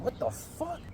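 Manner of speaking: high, hushed startled question; acted bewilderment. Man woken by a tap on his head, acted bewilderment.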